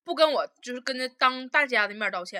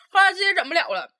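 ordinary delivery

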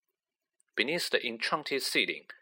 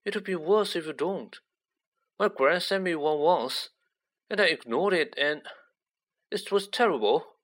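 put-on higher-pitched, timid boy's voice; mildly anxious schoolboy character; 6 semitones higher than usual. A narrator's reading. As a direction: warn a friend nervously, pitched up. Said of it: "said Neville in a timid whisper".

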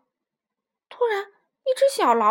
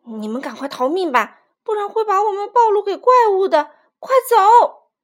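urgent shout; 7 semitones higher, 11 dB louder than usual. Acted. Old rooster urges them to flee.

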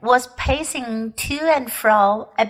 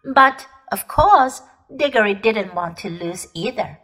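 ordinary delivery, nothing marked

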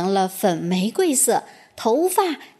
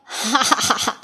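wild laugh. A narrator's reading. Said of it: "冰雪女皇狂笑着说".

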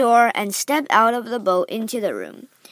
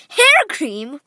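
surprised cry; 3 semitones higher, 4 dB louder than usual. Acted. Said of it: "cried Mike Teavee".

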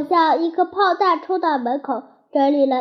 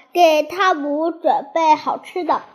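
usual speaking voice

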